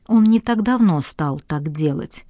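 ordinary delivery, nothing marked

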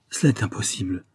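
plain reading voice

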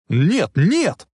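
loud, high frantic denial; shouted. A narrator's reading. Marty jumping up against his mother's concern, shouted denial. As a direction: yell out in protest. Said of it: "закричал он".

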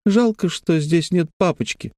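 put-on high child's voice missing daddy; wistful little girl. A narrator's reading. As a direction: voice a small girl's regret.

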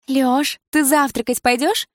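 high, playful call of the ex; sweet, teasing invite; ex-girlfriend calling him to breakfast, high and playful; playful woman's voice. Acted. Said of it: "раздался игривый женский голос".

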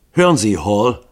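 loud, annoyed outburst; irritated call. A narrator's reading. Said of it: "rief Harkins offensichtlich gereizt".

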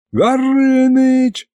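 high, long-drawn call for help; panicked yell. A narrator's reading. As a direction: holler the name in panic. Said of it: "в панике заорал я".

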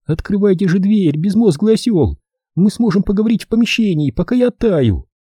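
put-on high, bossy young woman; girl's voice. A narrator's reading. Cold girl scolds Holman at the door, high bossy voice. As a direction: order him to open the door.

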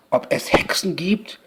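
usual speaking voice

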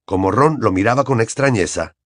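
ordinary delivery, nothing marked

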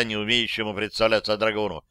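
usual speaking voice

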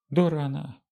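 hoarse narrated Vadim voice; hoarse from yelling. A narrator's reading. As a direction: rasp it out hoarsely. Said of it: "осипшим голосом выдал Вадим".

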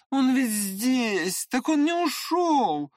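high, drawn-out cry of realisation; excited exclamation. A narrator's reading. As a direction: exclaim as if leaping up. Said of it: "воскликнул, срываясь с места".

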